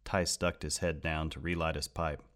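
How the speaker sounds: plain reading voice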